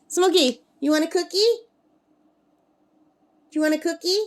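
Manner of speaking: high, lilting treat-offer voice; coaxing a pet. Real, not acted. Offering her bird a treat.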